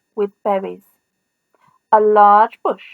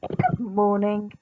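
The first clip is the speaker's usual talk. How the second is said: soft, slow, lower good morning; gentle reply; 4 semitones lower, 6 dB quieter, slower than usual. A narrator's reading. Elmer smiling back softly at the animals. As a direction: answer kindly and quietly.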